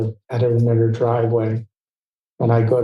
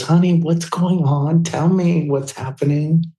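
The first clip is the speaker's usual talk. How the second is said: worried star; 6 semitones higher than usual. Retold or imitated. Star urging the tearful makeup artist to talk, worried and higher. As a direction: press him to open up, honey. Star presses him to explain.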